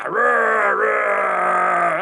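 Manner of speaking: loud playful monster roar; mock monster roar. Host playing the attacking monster for viewers, loud mock roar. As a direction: roar it like a giant toad monster.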